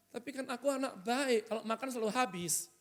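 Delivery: small pleading voice after a scolding; meek child. Acted. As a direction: play a sheepish child excusing themself.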